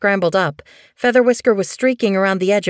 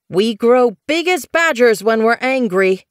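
loud, raised threatening warning; 7 semitones higher, 5 dB louder than usual. Acted. Bluefur threatening the kittypet, loud and menacing. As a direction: puff yourself up and threaten. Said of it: "she warned".

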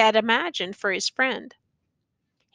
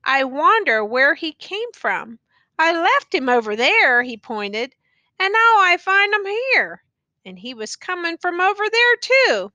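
high, bewildered questioning; puzzled; 7 semitones higher, 4 dB louder than usual. A narrator's reading. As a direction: wonder aloud, baffled. Youth's puzzled dialogue.

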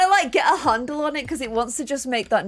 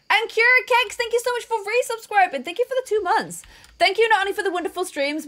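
high, upbeat streamer thank-you; high-pitched grateful announcement; subscriber shout-out; 6 semitones higher than usual. Real, not acted. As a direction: thank the new subscribers in a raised, lifted pitch.

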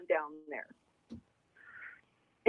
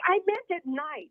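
higher, slow put-upon protest; exasperated protest; 10 semitones higher than usual. Retold or imitated. Telling the DJ off for calling at dawn, high and slow. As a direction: stretch it out, exasperated. Retold reply to early call.

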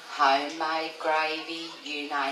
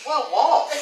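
retold yell; 7 semitones higher, 4 dB louder than usual. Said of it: "he'd yell".

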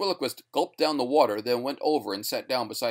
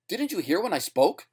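high-pitched appeal; desperate question; 7 semitones higher than usual. A narrator's reading. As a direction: plead to be believed. Desperate man pleading with listeners.

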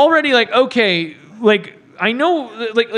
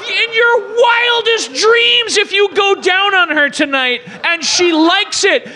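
exasperated tirade; 6 semitones higher than usual. Acted. Spelling out to Santa what he is asking, exasperated. Berating the film's hero.

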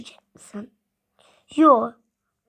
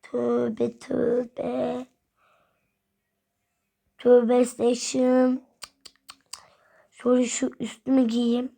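ordinary talk

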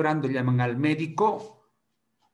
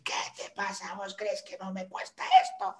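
scolding mother; 7 semitones higher, 4 dB quieter than usual. Retold or imitated. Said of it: "la mamá va a decir".

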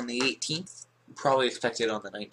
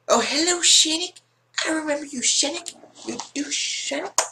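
very high put-on falsetto; squeaky greeting; 4 dB louder than usual. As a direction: greet them in a tiny squeaky voice. Squeaky greeting to another player.

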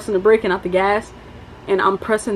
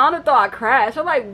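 usual speaking voice

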